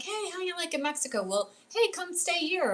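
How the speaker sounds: high-pitched, quick, casual invitation; inviting relative. Retold or imitated.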